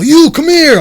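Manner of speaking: loud barking call to come over; retold yell. Friend yelling at a stranger to come here, loud. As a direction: shout him over across a crowd.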